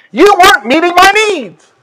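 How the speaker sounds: high, whiny complaining woman; complaining lady. Retold or imitated. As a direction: mimic a petty complainer. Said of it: "She said".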